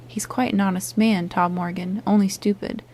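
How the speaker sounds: usual speaking voice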